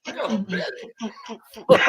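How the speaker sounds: high, laughing disbelief; laughing protest. Real, not acted. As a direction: plead through laughter. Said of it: "joking about his size".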